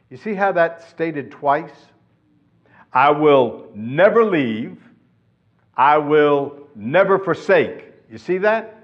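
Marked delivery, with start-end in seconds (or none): 2.9-7.9 slow and loud, leaning on "never"; emphatic repetition. Shown as a demonstration. Preacher shows the promise stated twice, emphatic on "never". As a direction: hit "never" hard in each phrase. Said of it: "just the repetition is emphatic".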